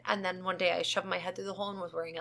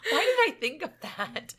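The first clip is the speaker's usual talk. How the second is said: laughing; 8 semitones higher, much slower than usual. Real, not acted. Laughing at own odd thought.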